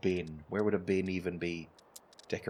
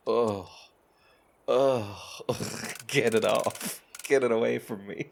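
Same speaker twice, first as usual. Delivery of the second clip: louder, groaning recoil; mock disgust; 4 dB louder than usual. Retelling reading good poetry, loud mock disgust. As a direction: push the poem away in mock revulsion. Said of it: "and I go".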